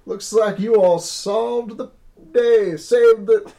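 high, loud drawling character voice; hammed-up drawl; slow drawl. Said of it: "I'm stuck on slow drawl".

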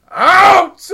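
loud, high, sudden command; a boss yelling out at two wrestlers; barked order. Retold or imitated. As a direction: throw them out with one word. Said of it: "he's just like".

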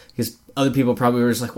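ordinary delivery

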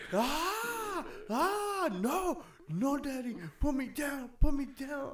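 put-on high, crying little boy begging daddy; whiny little kid. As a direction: squeal like a scared kid being lifted up.

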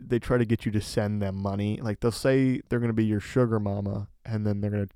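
usual speaking voice

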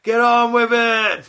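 high, drawn-out mock heckle; playful imitation of a rowdy heckler; heckling shout. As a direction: yell it slowly at the stage from the crowd. Acts heckling a stage show.

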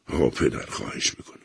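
pleading, fading to a breathless whisper; whimpering plea. A narrator's reading. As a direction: beg softly, voice dying away. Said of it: "ویلهلم نالید".

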